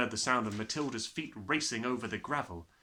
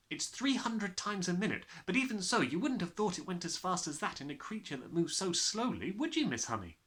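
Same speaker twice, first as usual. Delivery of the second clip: Matilda; 7 semitones higher than usual. Acted.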